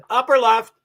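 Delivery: throat tight, forced out; choking. Real, not acted. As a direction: push the words past a cough. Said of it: "Sorry, I'm choking".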